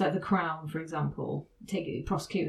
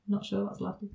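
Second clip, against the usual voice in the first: ordinary delivery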